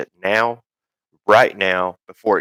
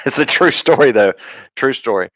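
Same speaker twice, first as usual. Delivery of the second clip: laughing it out, higher and louder; 5 semitones higher, 4 dB louder than usual. Real, not acted. Host laughs at his own accent joke while talking. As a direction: chuckle through the words.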